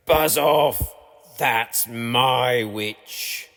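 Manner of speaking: very slow strange beast voice; monster voice. A narrator's reading. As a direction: drag out the threat like a swamp beast. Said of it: "a yowl and a growl and a croak and a shriek".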